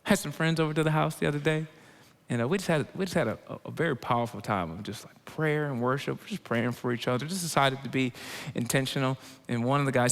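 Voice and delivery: ordinary delivery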